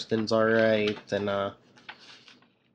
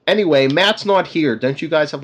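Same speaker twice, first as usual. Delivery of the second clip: raised, louder retold brush-off; lightly acted exasperation, pitched up; pointed brush-off; 4 semitones higher, 7 dB louder than usual. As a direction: push the point, acting out someone fed up. Acts out Kirsten brushing off Daredevil.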